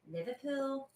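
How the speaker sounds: one word in Scouse, softly; Liverpool accent. Shown as a demonstration. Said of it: "sound like a Liverpoolian".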